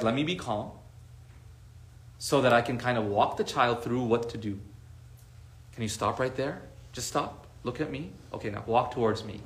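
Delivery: soft, low, steadying instructions; acted parent keeping quietly composed; calm parent. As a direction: talk a child through it calmly. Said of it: "approach is not to freak out".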